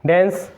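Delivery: डांस said as "dens", with an "e" vowel; correct pronunciation. Shown as a demonstration. Teacher repeats the right form for students, डांस as "dens". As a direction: say डांस to rhyme with "ens". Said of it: "डेंस बोलना आज से".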